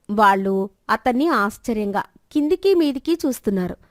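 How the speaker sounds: ordinary delivery, nothing marked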